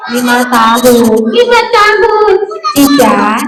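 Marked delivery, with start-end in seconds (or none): 1.3-2.4 high singsong puppet voice; high-pitched parroted answer; puppet pupil. Acted. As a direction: echo it high as a little puppet.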